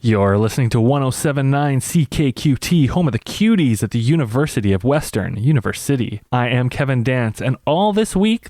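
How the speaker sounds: upbeat radio announcer read. Acted. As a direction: introduce the next song like a DJ. Radio DJ segment.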